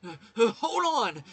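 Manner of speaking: frantic, high, loud cries of wait; desperate pleading. Acted. Mondo begging the mastermind to stop, panicked screaming. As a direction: beg in panic as they drag him off.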